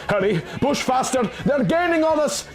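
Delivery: urgent shout. Acted. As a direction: pitch climbing high in mild alarm. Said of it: "Dalamar shouted".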